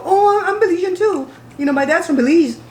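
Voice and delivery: imitating someone proudly claiming roots; imitated eager Americanized Belizean. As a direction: put on a high, loud "me too" voice. Acting out American-born Belizeans introducing themselves.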